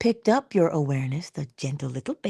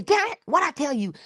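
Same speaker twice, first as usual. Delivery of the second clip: higher, sharp mock scold; 10 semitones higher than usual. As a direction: snap at the baby in pretend anger.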